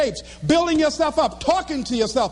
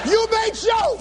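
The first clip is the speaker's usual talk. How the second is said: high, comic near-tears preaching; heartbroken speechifying; 9 semitones higher, slower than usual. Retold or imitated. Dumped speaker back before cheering crowd, heartbroken. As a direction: push the speech out through mock heartbreak.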